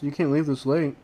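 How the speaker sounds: normal talking voice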